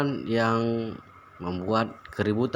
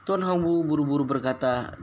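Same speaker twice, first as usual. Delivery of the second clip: plain reading voice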